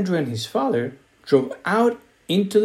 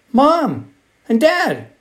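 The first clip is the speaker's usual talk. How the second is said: slow, higher call across the house; 9 semitones higher, 3 dB louder, slower than usual. A narrator's reading. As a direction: call out to the parents, drawing it out. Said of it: "called Andrew".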